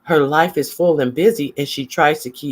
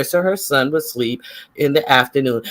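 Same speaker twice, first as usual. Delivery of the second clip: ordinary talk, nothing marked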